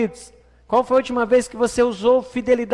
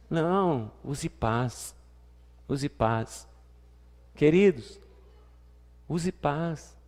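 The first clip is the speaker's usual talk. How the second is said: soft urging; 6 semitones lower, 5 dB quieter, much slower than usual. Real, not acted. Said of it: "gentle urging, much softer".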